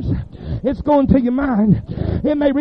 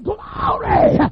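shouted glory. Retold or imitated. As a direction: throw out Glory! like leaping from the pew.

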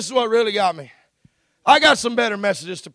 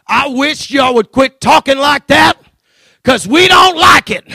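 suddenly loud, raised-voice demand; boy's defiant outburst, retold by a preacher; bold acted protest; 5 semitones higher, 12 dB louder than usual. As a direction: stand up to the grown-ups, loudly. Acts out boys confronting swearing adults.

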